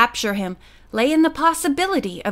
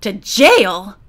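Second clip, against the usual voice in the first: louder, shocked question; mild shriek; 2 semitones lower, 6 dB louder than usual. A narrator's reading. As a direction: echo it back in alarm. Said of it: "the prisoner shrieked".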